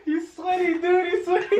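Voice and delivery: high, giggling through words; laughing. Real, not acted. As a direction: laugh while saying it. Laughing at sweaty friend.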